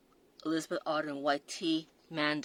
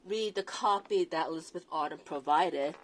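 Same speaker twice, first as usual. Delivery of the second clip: ordinary talk, nothing marked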